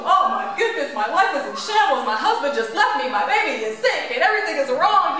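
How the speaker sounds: loud, hammed-up litany of woes; mock overwhelmed outpouring. Telling an acquaintance all that's wrong, mock overwhelmed. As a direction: pile up the troubles in comic despair. Acted outpouring of hidden troubles.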